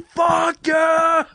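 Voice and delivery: high, drawn-out mock scream of rage; imitated scream. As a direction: scream it at a game boss, hammed up. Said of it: "Like, screaming at the boss".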